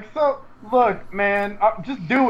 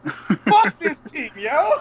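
laughing outburst; 8 semitones higher, much slower than usual. Real, not acted. Laughing at own bit.

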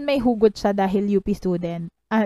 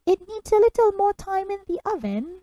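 a much higher, hammed-up British accent; bad British accent; 11 semitones higher than usual. Quoting a Bake Off judge in a bad British accent. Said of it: "I cannot do a British accent".

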